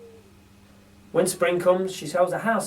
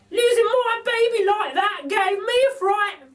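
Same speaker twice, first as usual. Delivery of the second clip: very high, slow, chirpy lady voice; a cheeky cockney burglar lady; Burglar Betty; 7 dB louder, slower than usual. A narrator's reading. Betty's held character voice.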